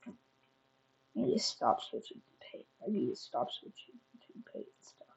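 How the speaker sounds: whispering to self; whispered aside. Real, not acted. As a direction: mutter it under your breath. Muttered to self.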